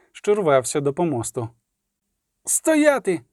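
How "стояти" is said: rider's shout. A narrator's reading. Rider halting the execution, high mild shout. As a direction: call a halt in a high voice. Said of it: "крикнув вершник".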